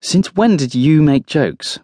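slow, high, pointed challenge; drawn-out, pointed query; pointed question. A narrator's reading. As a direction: ask it pointedly, stretching it out. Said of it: "Paula asks pointedly".

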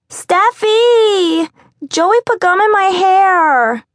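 put-on very high, loud child complaint; little girl wailing. A narrator's reading. Little girl complaining to Steph about Joey, high child wail. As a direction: wail like a small girl tattling. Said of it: "a shrill scream emanated".

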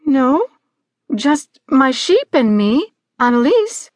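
put-on high, hesitant girl facing a creature; Annalise. Girl answers Hagski.

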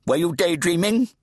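put-on high, demanding deaf old priest; fat Redeemer. A narrator's reading. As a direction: bark at the boy to speak up.

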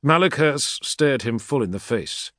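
ordinary delivery, nothing marked

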